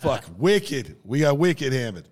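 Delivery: loud, hammed-up Boston slang; imitated thick Boston accent. A traveler imitating locals at the bar, mock Boston accent. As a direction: do a bad Boston accent, punched up. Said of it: "thick Boston accents".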